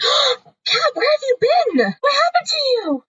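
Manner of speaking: high, gushing barista voice; mock excited barista welcoming her back; Starbucks barista greeting. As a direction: gush high like a barista seeing an old regular. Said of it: "they're like".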